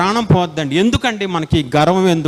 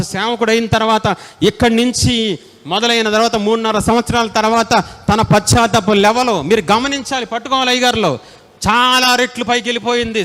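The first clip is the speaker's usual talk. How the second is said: normal talking voice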